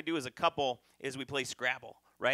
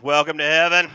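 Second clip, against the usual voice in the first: booming welcome; 15 dB louder than usual. Acted. Angel welcomes him in.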